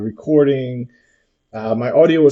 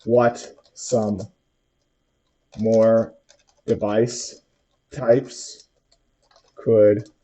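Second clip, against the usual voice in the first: slow, careful dictation to a voice assistant; dictating; slower than usual. Shown as a demonstration. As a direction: spell it out word by word for the machine. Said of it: "I'm gonna say".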